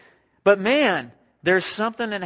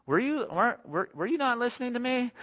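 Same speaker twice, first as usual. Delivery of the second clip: imitating the wife, high pitched and exasperated; mock wife; 8 semitones higher, 4 dB quieter than usual. Pastor mimics an annoyed wife asking if he listened, high. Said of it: "heard your wife say to you".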